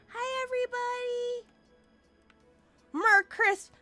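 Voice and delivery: ordinary talk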